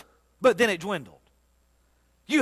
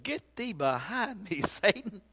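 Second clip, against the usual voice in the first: quiet rebuke; 10 dB quieter, slower than usual. Retold or imitated. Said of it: "Jesus looked at him and said".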